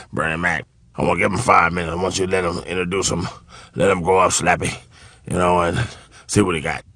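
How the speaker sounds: low, soft mumbled instructions; old-timer's low grumble, acted; imitating older comedian. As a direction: mumble the favour low and casual. Acts Foxx instructing Slappy.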